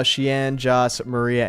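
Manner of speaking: usual speaking voice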